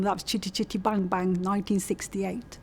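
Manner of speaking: ordinary talk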